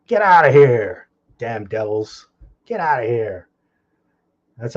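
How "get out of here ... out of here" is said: shout. Retold or imitated. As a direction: bellow it like chasing off thieves. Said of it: "that's how you should be treated".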